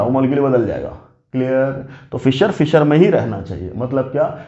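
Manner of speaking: usual speaking voice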